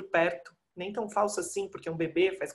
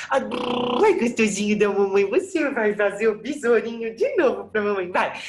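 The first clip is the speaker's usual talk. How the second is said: put-on sing-song baby talk, a little higher and louder; doting baby talk; 4 semitones higher, 4 dB louder than usual. Mother cooing at her baby son, doting baby talk. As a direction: coo at the baby to coax him into playing.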